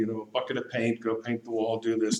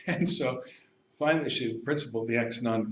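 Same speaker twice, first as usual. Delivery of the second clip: normal talking voice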